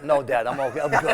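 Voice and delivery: loud, laughing no to dad; laughing refusal. Retold or imitated. Son to old father, refusing his name with a laugh. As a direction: brush dad off while laughing. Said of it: "I go".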